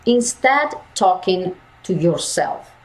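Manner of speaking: normal talking voice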